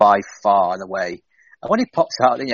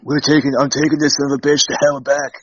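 imitating a snarling wrestler, higher and louder; Austin's threat; 5 semitones higher, 5 dB louder than usual. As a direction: growl it like a brash heel cutting a promo.

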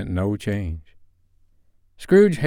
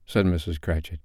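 ordinary delivery, nothing marked

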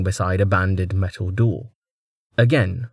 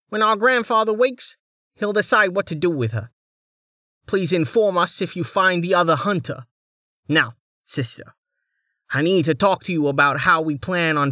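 thin reedy voice; 8 semitones higher than usual. Acted. Male noble voiced.

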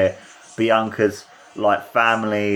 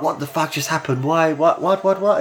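high, incredulous what-the-hell voicing of Bianca; stunned disbelief; 7 semitones higher than usual. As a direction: act out Bianca's shock, rising each what. Bianca's stunned reaction voiced.

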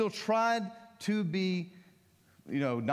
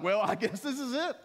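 mock doomed disciple; 6 semitones higher than usual. Disciples resigned if Jesus scared.

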